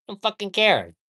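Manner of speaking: high, loud bored customer voice; careless customer. Pretend. Acting a careless drive-thru customer.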